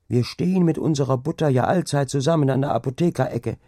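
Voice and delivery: high, lively, breathless village gossip; excited gossiping maid. Acted. Maid Angrete gossiping to the other maids, high and excited. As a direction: spill the scary news in a rush.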